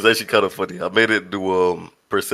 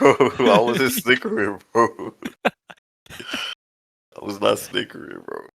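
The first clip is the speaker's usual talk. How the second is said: laughing; slower than usual. Real, not acted. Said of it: "I heard a snicker".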